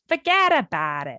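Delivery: hammed-up Jersey "fuhgeddaboudit"; broad mock Jersey vowels; Jersey accent. Joking after a forgetful story, hammed-up Jersey accent. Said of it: "If anyone's from Jersey".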